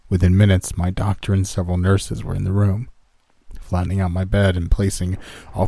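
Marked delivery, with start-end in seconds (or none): none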